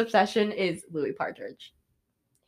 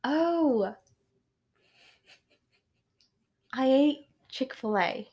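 slow, higher attempt at British; British accent; 4 semitones higher, slower than usual. Answering what she ate today, trying a British accent. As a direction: haltingly put on a British accent. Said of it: "just speak in a British accent".

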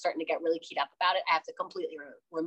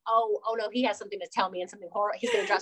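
mock dread; faster than usual. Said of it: "It's like".

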